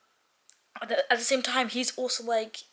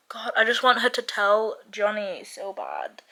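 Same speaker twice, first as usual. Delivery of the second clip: usual speaking voice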